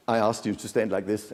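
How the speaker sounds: normal talking voice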